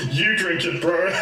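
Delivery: high, laughing read-out. Real, not acted. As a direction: crack up while reading it out. Laughing at a reply he reads.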